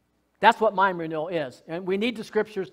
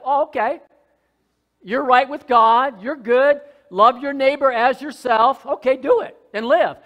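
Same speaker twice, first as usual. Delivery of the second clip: louder, breezy mock approval; mock cheerful law; 6 semitones higher, 8 dB louder than usual. Pastor mocking the law's easy answer, louder and breezy. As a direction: toss off a hollow "you're good".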